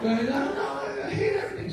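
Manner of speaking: high hammed-up wail; despairing outburst. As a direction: imitate a wretched friend, crying out. Acts out Rick's despair again.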